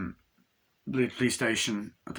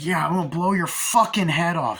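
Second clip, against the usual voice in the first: loud, raised mock-villain threat; angry threat; 8 semitones higher, 6 dB louder than usual. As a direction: imitate a gunman threatening, loud and high.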